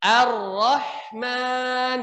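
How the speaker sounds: slow Ar-Rahman with trilled R; drawn-out Ar-Rahman; Quran recitation. Shown as a demonstration. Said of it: "Huruf H-nya dialirkan".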